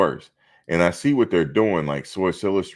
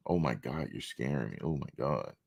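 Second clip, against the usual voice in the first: much softer, breathy mock fright; mock scared whimper; 11 dB quieter than usual. Imitating coworkers faking fear of him, hushed and mocking. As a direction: barely voice it, like a fake victim. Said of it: "that's what they do".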